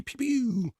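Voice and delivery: high, quiet zappy pew; a little cartoon blaster shot; soft laser pew. Host doing a countdown laser sound. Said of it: "We'll do one, two, three".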